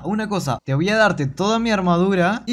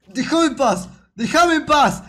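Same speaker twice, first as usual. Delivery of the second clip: high-pitched cry to be left alone; real gamer panic, raised pitch; panicked pleading; 7 semitones higher, slower than usual. Real, not acted. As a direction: cry out at a pursuer. Crocodile chasing the player.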